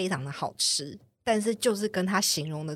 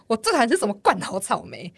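exasperated rant; 4 semitones higher, 7 dB louder than usual. Real, not acted. Ranting about hated cream cake.

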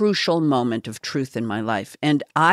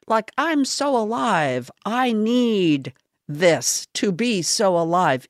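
mock self-justifying; 6 semitones higher than usual. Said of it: "my belief that I was so alive".

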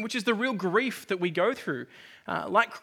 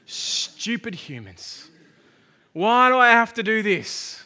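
slow, drawn-out whinge, building to loud protest; hammed-up resentful moan, swelling much louder; mock exasperation; 2 semitones higher, 10 dB louder, slower than usual. Preacher plays a Jesus who resents humans, mock exasperation.

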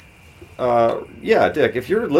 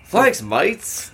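Thanks mates in a mock Australian accent, first word jumping high; a hammed-up Aussie thank-you, badly faked Australian; 7 semitones higher than usual. Podcast host thanking Australian listeners in a badly faked Australian accent. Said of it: "it was a poor, accent".

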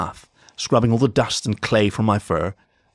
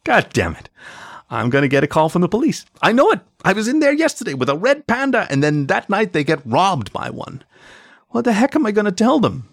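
high-pitched frantic rant; agitated, dreading trouble; panicked exasperation; 11 semitones higher than usual. A narrator's reading. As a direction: climb into a high, worked-up fret. Owner panicking about police call.

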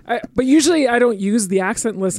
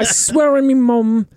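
put-on slow, louder British accent; British slang accent; 4 semitones higher, 6 dB louder, slower than usual. Showing friends the British line for the dog, slow British accent. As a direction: lay on the London accent nice and slow. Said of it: "Yeah, I only say".

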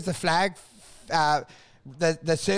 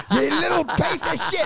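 higher, laughing curse; laughing insult; 8 semitones higher than usual. Retold or imitated. Watching the cancelling driver reverse, laughing curse. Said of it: "you're like".

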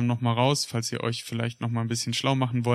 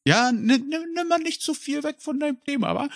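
high put-on Berlin-ish tease; teasing high voice; 13 semitones higher than usual. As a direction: squeak it out teasingly.

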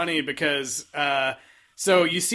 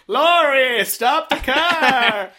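mock pleading call; 6 semitones higher, 4 dB louder, much slower than usual. Both Eddies pleading.

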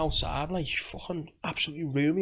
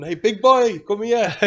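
raised, louder catcall; imitated street shout, pitched up; 6 semitones higher, 8 dB louder, slower than usual. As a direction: call out loudly to a passer-by. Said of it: "and shouting".

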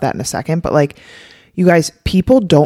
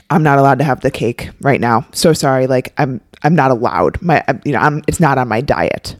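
low, deprived and apologetic; subdued, sorry-for-yourself refusal; restrictive, unhappy; 3 semitones lower than usual. Shown as a demonstration. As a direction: turn down cake as if forbidden, sounding unhappy. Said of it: "The first one sounds very restrictive".

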